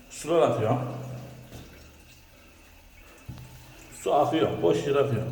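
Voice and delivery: echoing off tiled toilet walls; bathroom echo. Real, not acted. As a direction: voice ringing in a small echoey room. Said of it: "sesim yankılanıyor".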